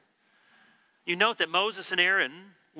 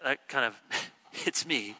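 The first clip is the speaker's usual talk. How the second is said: normal talking voice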